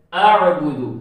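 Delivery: अअबुदु with a throaty ain after hamza; ain demo. Shown as a demonstration. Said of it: "ऐसे ही आगे जी है". (आइन के साथ अअबुदु)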